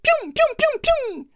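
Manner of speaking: very high fast bouncy sounds; hopping sound. Acted. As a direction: bounce the words. Mice hop away.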